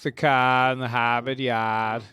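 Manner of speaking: put-on slow Boston accent, dropped r's; park the car like a Bostonian. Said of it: "speak like".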